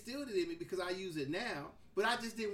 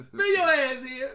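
loud, laughing call from mama; mother's loud summons; 4 semitones higher, 15 dB louder than usual. Retold or imitated. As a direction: yell it up the stairs through a laugh.

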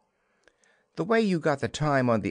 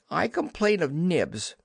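put-on quick, raised boy's complaint; eager complaint; 7 semitones higher than usual. Slightly tattling on Nibs to Mummy, eager raised call. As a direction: tattle on Nibs at once, pitched up. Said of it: "he cried promptly".

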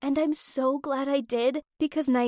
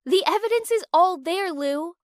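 high, insistent and louder; adamant; 6 semitones higher, 5 dB louder than usual. A narrator's reading. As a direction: insist on it, pitched up. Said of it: "Naomi was adamant".

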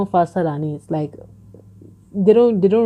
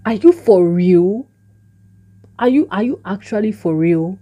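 disbelief; 3 semitones higher, 4 dB louder than usual. Retold or imitated. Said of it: "I'm like".

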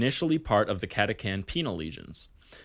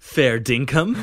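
loud Aussie fair dinkum; hammy Australian accent, raised; broad Australian accent; 3 semitones higher, 7 dB louder, slower than usual. Acted. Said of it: "dubbed this accent Aussie".

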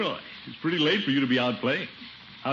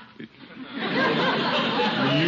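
hearty chuckle. Acted. Uncle laughs at Leroy.

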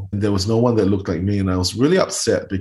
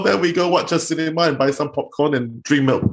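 ordinary delivery

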